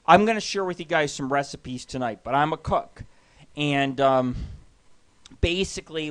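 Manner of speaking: ordinary talk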